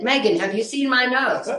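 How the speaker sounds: pitched high, playful rhetorical question; high teasing. Retold or imitated. Asking her niece Megan about her big nose, high teasing. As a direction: tease her with a high, mock-offended ask.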